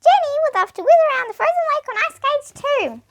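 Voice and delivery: high mouse voice; high squeaky mouse chatter. Acted. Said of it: "said my Mouse".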